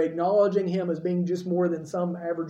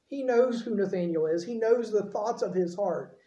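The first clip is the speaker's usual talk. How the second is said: usual speaking voice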